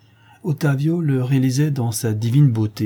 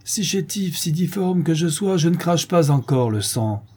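sour retort; 7 semitones higher than usual. A narrator's reading. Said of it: "répond aigrement Felipe".

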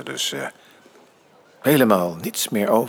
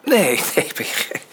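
laughing denial; 6 semitones higher than usual. Acted. Father assuring his son he should stay, laughing. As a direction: laugh the no away.

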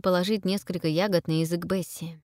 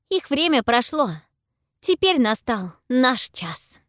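prophesy in rapt wonder; awed girl voice; 8 semitones higher, 6 dB louder than usual. A narrator's reading. Pippa's voice.

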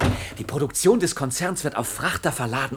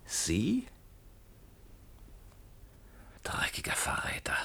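whispered, soft contempt; bitter whisper; 12 dB quieter, slower than usual. Acted. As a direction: hiss it at the traitor under your breath. Betrayed man facing traitor.

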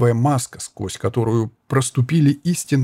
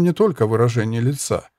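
usual speaking voice

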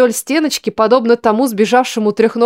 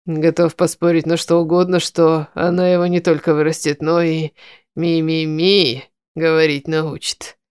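smirking; 4 semitones lower than usual. A narrator's reading. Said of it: "усмехнулся Рев".

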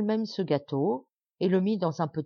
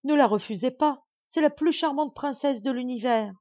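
afflicted pleading; 7 semitones higher, 4 dB louder than usual. A narrator's reading. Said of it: "continua la reine affligée".

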